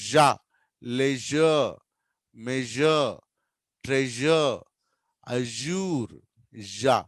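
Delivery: లెజర్, మెజర్, ట్రెజర్, అజూర్ each with a buzzed zh; spaced word list, lingering on zh in మెజర్ and ట్రెజర్; జా said as "zha", not a hard j; careful zh drill. Shown as a demonstration. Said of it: "ఈ sound మనకు తెలుగులో లేదు".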